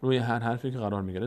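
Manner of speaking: usual speaking voice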